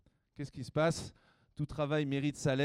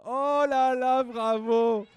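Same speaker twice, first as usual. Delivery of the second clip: excited; 10 semitones higher, 11 dB louder, much slower than usual. Real, not acted. Girls guess right, praised.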